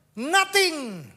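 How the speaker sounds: nothing stretched high and loud; nothing flung high with falling tune; emphatic nothing. Acted. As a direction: punch out nothing. Preacher stresses empty wilderness.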